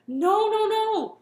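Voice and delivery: loud, high no no no; loud protest. Acted. Chef protesting to the monster king. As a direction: cry out to stop him.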